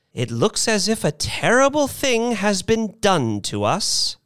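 put-on high-pitched elderly speechmaker; aggrieved old lady. A narrator's reading. Missus Bellows from the rocket doorway, aggrieved.

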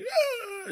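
hammed-up high crying wail; mock a big sob, very high; mock boo-hoo wail. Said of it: "not, like, boo-hooing".

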